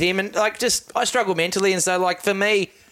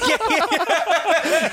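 normal talking voice

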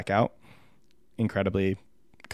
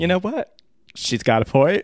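pitched-up mock pop-diva quote; 7 semitones higher than usual. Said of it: "That was an awful [name] impression".

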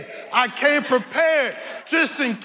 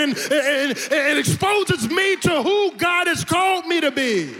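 preaching at full force; 6 semitones higher than usual. Acted. Preacher rousing the congregation, full-force preaching. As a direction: build the call to encounter God.

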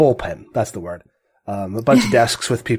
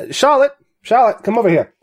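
calling out; 11 semitones higher, 4 dB louder than usual. Acted. Editor calls his reporter across the bullpen, calling out. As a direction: call her over, higher and louder.

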